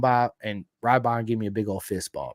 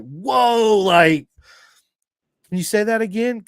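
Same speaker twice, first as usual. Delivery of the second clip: mock surprise; 8 semitones higher, 7 dB louder, slower than usual. Imitating the receptionist's surprise.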